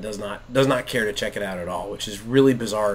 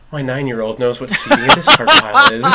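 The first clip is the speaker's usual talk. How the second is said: loud, higher amazed outburst, breaking into laughter; thrilled dad; 6 semitones higher, 11 dB louder than usual. Retold or imitated. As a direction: retell your proud astonishment at full volume. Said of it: "my heart fluttered, and I went".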